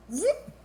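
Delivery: short, high whoop sound effect; a quick rising "whoop" for snatching something up; scooping-up whoop. Pretend.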